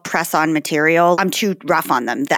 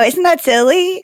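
high, sing-song mock mom tone; playful mom voice; 11 semitones higher, 4 dB louder than usual. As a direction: coax a child into laughing along. Mom playing along with daughter.

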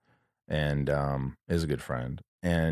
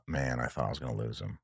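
choked up; 6 semitones lower, 8 dB quieter than usual. Real, not acted. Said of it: "get a little emotional".

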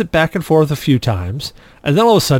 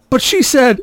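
high-pitched mock protest; imitated boyish complaint, pitched way up; pleading protest; 10 semitones higher than usual. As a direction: object like a kid sent away. Boy protests to dad.

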